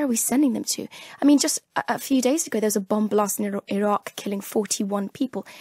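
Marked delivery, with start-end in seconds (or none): none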